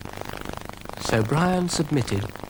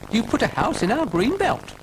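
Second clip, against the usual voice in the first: high little snail voice; officious little snail; 12 semitones higher than usual. A narrator's reading. Brian the snail objects to Dougal's caravan, high officious voice. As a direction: answer in a high, officious pipe. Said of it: "said Brian".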